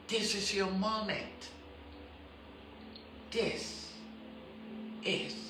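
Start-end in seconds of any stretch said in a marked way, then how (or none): 3.3-5.3 tender hush of an altar call; hushed repeat. Real, not acted. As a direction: lean in and whisper each word.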